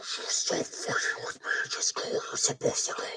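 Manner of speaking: hushed, pleading whisper at a stubborn thing; whispered scolding under the breath; exasperated whisper. Real, not acted. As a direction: whisper it through frustration, very soft. Muttering at the stubborn model piece.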